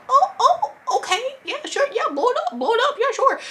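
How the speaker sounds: a very high, giggly blow it up yeah; giddy laughing yes. Retold or imitated. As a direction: squeal yes through laughter. Giddy that the admin helps.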